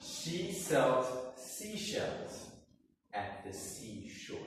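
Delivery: She sells seashells said very slowly, each word crisp; over enunciating. Shown as a demonstration. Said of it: "So I'll say it again".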